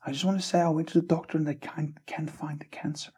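high voice for the healed woman's news; excited woman. Retold or imitated. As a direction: voice her testimony up high and thrilled. Woman testifying healing on stage.

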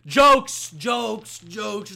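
loud sudden "jokes", then easing off; jokey retraction. Real, not acted. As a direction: call it out loudly to take back the joke. Retracting his sex tape joke.

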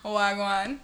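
laughing fake Jamaican Wagwan; a playful Jamaican-accent Wagwan; light mock Jamaican greeting. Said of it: "my fake Jamaican accent".

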